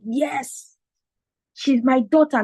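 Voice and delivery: high, imitated boast; proud dad. Dad seeing daughter on TV, proud. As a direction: crow about your child as dad. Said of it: "my dad was so proud".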